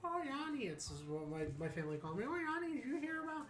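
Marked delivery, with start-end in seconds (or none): 0.0-0.7 high sweet granny voice; grandmother voice. Retold or imitated. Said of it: "my grandmother ... she's like". 2.3-3.5 high sweet granny voice; grandmother voice. Retold or imitated. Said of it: "my grandmother ... she's like".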